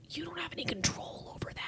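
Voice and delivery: hushed whisper, calming yourself; talking herself down from panic in a whisper; whispered self-talk. Retold or imitated. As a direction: whisper it to yourself to stay calm.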